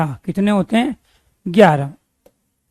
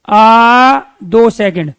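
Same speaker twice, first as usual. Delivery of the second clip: slow, louder, stretched out आ; long vowel demo; 5 dB louder, much slower than usual. Shown as a demonstration. Teacher stretching आ for students, slow and loud. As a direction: stretch आ for two full seconds. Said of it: "देखो उच्चारण करके".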